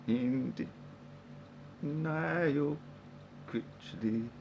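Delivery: teasing, long-held "denial" in place of Daniel; drawn-out name pun. Pretend. Plays on the name.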